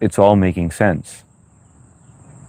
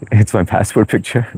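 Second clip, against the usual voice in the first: laughing through the words; 2 semitones higher than usual. Real, not acted. Chuckling to Blue about his passport photo, laughing. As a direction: giggle as you say it.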